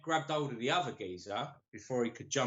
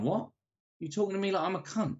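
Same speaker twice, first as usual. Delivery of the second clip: retold affronted comeback, higher and fast; mock indignant challenge; 7 semitones higher than usual. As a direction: act out a cousin squaring up, pitch jumping. Retells cousin squaring up to man.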